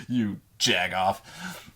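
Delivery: slow, drawn-out teasing jab; slow mock insult. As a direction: drag it out like an amused DM. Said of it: "He's like".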